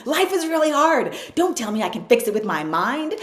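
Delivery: high, loud, laughing complaint; whiny younger self. Retold or imitated. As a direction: whine through a laugh at mom. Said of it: "and I'd be like".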